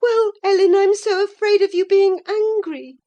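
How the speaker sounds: put-on girl pleading through tears; tearful girl. A narrator's reading. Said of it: "bursting into tears".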